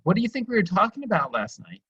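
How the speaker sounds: pitched high, genuinely curious; high curious question. Shown as a demonstration. Parent asking a kid with genuine curiosity, high and open. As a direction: ask it bright and lifted, not accusing. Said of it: "see how my voice drops down".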